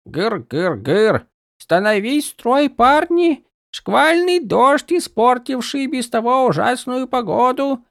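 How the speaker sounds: hammed-up barking of orders; mock drill sergeant. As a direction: parody a grumpy sergeant. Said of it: "стала точной копией Каладина". (пародия на сурового сержанта)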